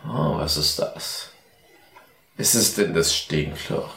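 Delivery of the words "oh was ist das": ordinary delivery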